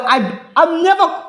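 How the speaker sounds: never stretched high and slow; stress on never. Real, not acted. Insisting to the co-host, never pushed up high. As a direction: lean hard on never.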